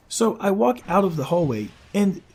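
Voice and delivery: ordinary delivery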